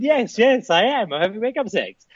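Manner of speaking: high, excitable arguing back; comic insistence. Retold or imitated. Husband retelling argument with wife, high comic insistence. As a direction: insist in a high mock-desperate voice.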